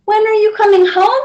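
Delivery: a lightly voiced pleading child; little girl asking. A narrator's reading. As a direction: ask like a lonely little girl. Child asks parents.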